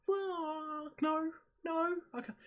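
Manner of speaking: high, squeaky horrified voice; retold horror. Watching her slam the dog's head down, high-pitched horror. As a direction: jump way up in pitch, appalled. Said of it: "I'm like".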